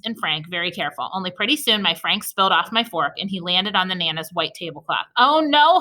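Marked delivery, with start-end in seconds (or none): 5.2-5.8 high loud cry of oh no; holler. Acted. Lucille hollers at the spill on the tablecloth, loud. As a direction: yell it out in alarm. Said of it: "hollered Lucille".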